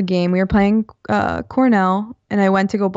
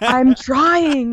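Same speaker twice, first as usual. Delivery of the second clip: higher-pitched mock-exasperated protest; retold plea, pitched up in frustration; 7 semitones higher, 4 dB louder than usual. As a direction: protest as if unfairly yelled at. Protesting back to yelling dad.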